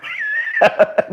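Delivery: squeaky breathless laugh; high wheezing giggle; delighted high laugh. Real, not acted. Laughing at Pacquiao.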